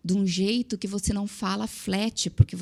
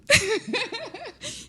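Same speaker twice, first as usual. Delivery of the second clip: very high, squealing laugh; high giggling burst; 14 semitones higher than usual. Real, not acted. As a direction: crack up mid-story. Laughs at her retold confusion.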